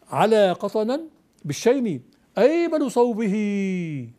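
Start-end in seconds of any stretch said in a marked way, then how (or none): 2.4-4.1 slow recitation, drawing صوبه out to "sawbihii"; lengthened verse ending. Shown as a demonstration. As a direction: hold the long final i of صوبه. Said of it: "تقول". (إنشاد بإشباع الهاء في صوبه)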